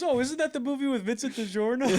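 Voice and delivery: high, loud laughing imitation; imitating dad through laughter. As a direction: mimic an oblivious dad, cracking up. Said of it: "He goes, he goes".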